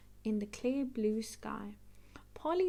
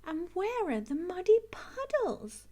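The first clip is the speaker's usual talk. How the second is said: put-on high, louder little girl voice; Peppa's voice; 8 semitones higher, 5 dB louder than usual. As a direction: ask it like a disappointed small girl. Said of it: "asks Peppa".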